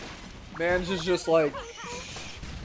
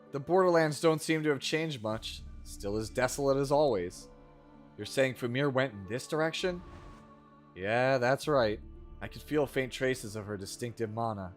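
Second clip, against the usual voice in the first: bad acting; 5 semitones lower, 6 dB quieter than usual. Reads game dialogue aloud.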